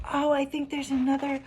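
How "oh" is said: ordinary delivery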